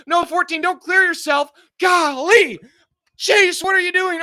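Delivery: loud, high exasperated shout; angry yelling. Real, not acted. Streamer berating driver fourteen, loud and high. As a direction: yell at the driver who blew it.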